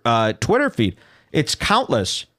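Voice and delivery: ordinary talk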